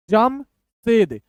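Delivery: slow, halves clearly separated; broken in two. Shown as a demonstration. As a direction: split it after the first syllable. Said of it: "दो दो अक्षर तोड़ तोड़ के".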